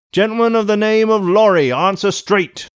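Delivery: high, loud summons; calling out. A narrator's reading. Guard summoning a passenger, loud high call. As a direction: holler for someone by name.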